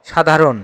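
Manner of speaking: সাধারণ said as "sha-dha-ron"; careful word alone, সাধারণ ending in "-ron"; model pronunciation. Shown as a demonstration. Teacher gives the answer for সাধারণ, modelling "sha-dha-ron" carefully. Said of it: "সাধারণ শব্দের উচ্চারণ হবে".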